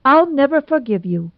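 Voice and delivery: put-on an angry girl refusing to forgive; cried out. A narrator's reading. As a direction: fling it out in outrage. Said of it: "cried Nora".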